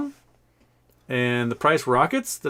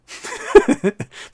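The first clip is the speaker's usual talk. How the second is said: high, breathy burst of giggles; amused laugh; 6 dB louder than usual. Real, not acted. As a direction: laugh at your own joke. Laughs at his own joke.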